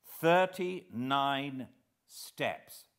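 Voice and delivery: "Thirty-nine steps" drawn out slowly, falling; reading "Thirty-nine steps" aloud from Scudder's book, slowly. A narrator's reading. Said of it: "I read".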